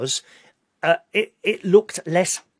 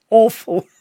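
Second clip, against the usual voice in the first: louder, higher, punched AWFUL; emphatic repeat; 6 semitones higher, 8 dB louder than usual. Retold or imitated. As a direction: slam the word "Awful." as a final verdict. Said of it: "she said".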